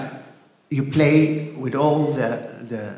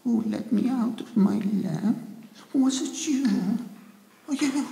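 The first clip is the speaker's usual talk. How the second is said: high, soft, timid genie; frightened genie; 9 semitones higher, 6 dB quieter than usual. Shown as a demonstration. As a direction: shrink back, scared. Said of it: "pačiam išsigandusiam".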